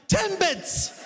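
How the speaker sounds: high-pitched mock customer repeat; high, incredulous put-on voice; exasperated customer. Acted exasperated customer repeating herself.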